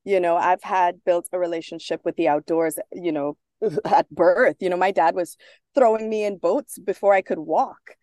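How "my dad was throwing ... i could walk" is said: normal talking voice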